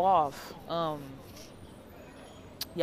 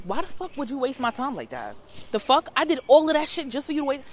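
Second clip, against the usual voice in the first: indignant replay, higher and getting louder; retold angry outburst; 6 semitones higher, 4 dB louder than usual. Going off on her over wasted time, retold anger. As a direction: build heat as you replay the rant. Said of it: "why was I so angry?".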